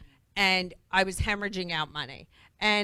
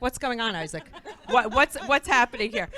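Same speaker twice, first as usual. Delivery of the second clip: high, loud, rapid questions with a laugh; a suspicious wife demanding answers, half laughing; mock panicky wife; flustered wife; 3 semitones higher, 6 dB louder than usual.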